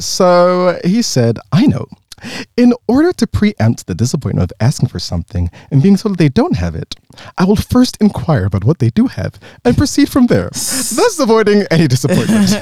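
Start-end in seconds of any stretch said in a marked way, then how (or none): 1.5-12.5 posh formal voice. Retold or imitated. Posh American friend's formal speech.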